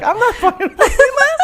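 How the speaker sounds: very high, loud retold refusal breaking into laughter; laughing mock refusal. Comic mimics bartender refusing the song, laughing hard. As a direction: play the annoyed bartender while cracking up.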